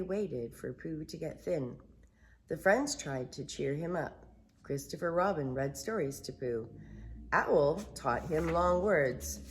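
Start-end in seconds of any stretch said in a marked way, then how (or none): none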